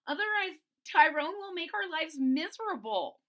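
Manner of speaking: high, anxious warning; worried Stego. Acted. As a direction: plead with your friend. Friend Stego voiced.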